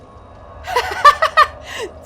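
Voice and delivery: high squeaky cackle; squeaky gleeful gremlin giggle. Real, not acted. Laughs after chat joke.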